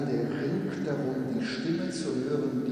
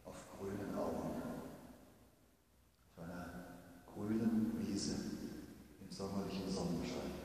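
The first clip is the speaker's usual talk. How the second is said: quiet liturgical recitation; soft psalm reading; 3 semitones lower, 9 dB quieter than usual. Acted. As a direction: read the psalm gently, as a prayer. Reads Psalm 23 softly.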